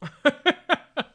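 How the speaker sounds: high, loud staccato laugh. Real, not acted. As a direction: burst into sharp, high cackling. Reacts during game.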